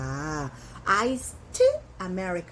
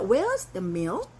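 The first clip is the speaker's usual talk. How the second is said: Where's the milk said very slowly, each word drawn out; slow model question at the store; very slow demo; much slower than usual. Shown as a demonstration. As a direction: ask for the milk slowly and clearly. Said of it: "이렇게 하시면 되겠어요".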